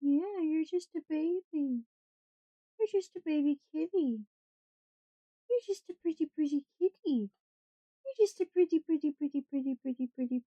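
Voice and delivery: gentle pet talk, mostly soft. Real, not acted. Cooing baby talk to cat.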